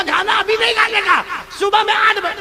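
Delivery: high, loud exasperated teacher; a fed-up teacher shouting at a child at night; teacher yells. Retold or imitated. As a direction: yell at the child to wait till morning. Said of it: "teacher بہت زور سے".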